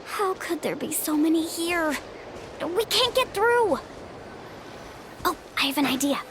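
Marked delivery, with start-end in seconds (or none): 2.6-3.8 high, slow cry of dismay; dismayed exclamation. Acted. Explorer finds the herd blocking the way, dismayed. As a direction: draw it out high, alarmed at being blocked.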